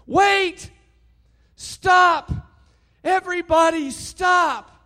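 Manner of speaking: high, loud desperate yell to halt everyone; frantic shout. Retold or imitated. Gomez stopping the staged shooting in the gym, frantic high shout. Gomez leaps up, stops the scene.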